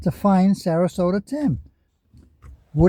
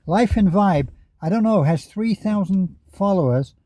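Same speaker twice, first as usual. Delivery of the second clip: normal talking voice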